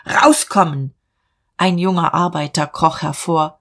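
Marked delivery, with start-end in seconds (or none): 0.1-0.9 moderately raised, stern command; shouted command. A narrator's reading. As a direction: order the culprit out sharply. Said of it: "schrie er".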